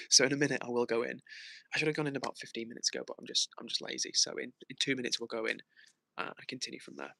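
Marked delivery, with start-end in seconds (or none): none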